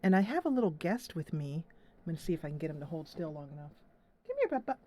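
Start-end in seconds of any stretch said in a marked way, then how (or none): 4.3-4.8 cooing to cat. Real, not acted. Calling the cat over.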